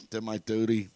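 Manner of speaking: usual speaking voice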